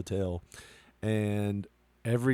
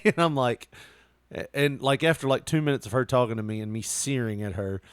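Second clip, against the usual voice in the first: ordinary delivery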